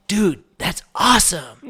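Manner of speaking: high, slow, gushing mock American; drawn-out American dude exclamation; imitated American accent. American stranger praises the voiceover, hammed-up American accent. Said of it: "That's so American".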